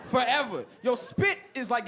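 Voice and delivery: usual speaking voice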